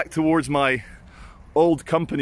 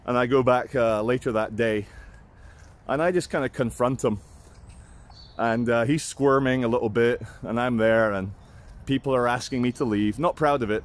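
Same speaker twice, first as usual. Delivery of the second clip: ordinary talk, nothing marked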